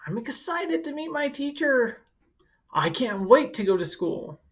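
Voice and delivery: light pretend excitement from a student; excited child. Student looking forward to meeting the teacher, eagerly excited. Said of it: "you might even become excited".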